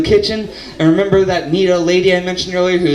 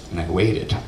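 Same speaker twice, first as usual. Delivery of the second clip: lower, softer repeat; 10 semitones lower, 7 dB quieter than usual. Acted. Storyteller stretching the wait, low deadpan repeat. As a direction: drop it low and soft, deadpan.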